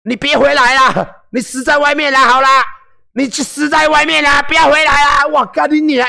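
high, loud mocking tirade; mock angry cursing. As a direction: rant like a furious troll yelling. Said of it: "都一直这样骂".

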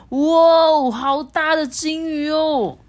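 high, loud, drawn-out wow; excited shout. A narrator's reading. Monkey shouts at the leaping whale, amazed. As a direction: cry out in amazement, stretching the words. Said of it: "飞普大叫说".

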